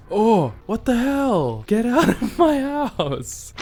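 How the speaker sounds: high, loud, slow laughing yell; laughing shout. Real, not acted. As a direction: yell through laughter at the intruder. Startled by an intruding mob.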